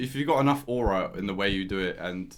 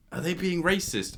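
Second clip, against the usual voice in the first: higher, incredulous question; questioning reaction; 7 semitones higher than usual. Retold or imitated. Judging a stranger's accent, questioning. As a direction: ask it in higher pitch, taken aback. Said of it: "you're like".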